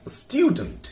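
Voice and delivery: स्टूडेंट as स्टू then डंट, said high; two-part pronunciation. Shown as a demonstration. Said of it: "पहला है स्टू, दूसरा है डंट". (दो खंड में स्टूडेंट)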